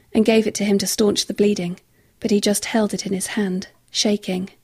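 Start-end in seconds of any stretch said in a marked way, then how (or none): none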